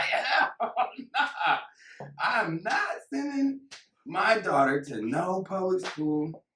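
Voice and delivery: very high, comic parent refusal; hammed-up high-pitched protest; mock indignant parent. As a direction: play an outraged parent, squeaky high and slowing down.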